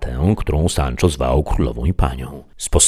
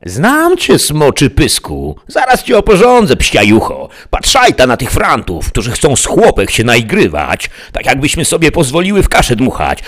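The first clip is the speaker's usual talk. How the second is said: much higher, loud coarse scolding; furious peasant girl; 9 semitones higher, 6 dB louder than usual. A narrator's reading. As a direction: tear into them like an angry farm girl. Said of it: "rzekła".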